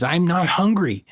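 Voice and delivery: put-on high, sulky little-boy denial; stubborn child. Father imitating his son denying hunger, high and sulky. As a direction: play a pouting toddler insisting. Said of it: "And he says".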